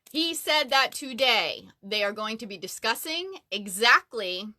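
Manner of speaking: normal talking voice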